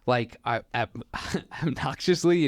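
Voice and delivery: ordinary delivery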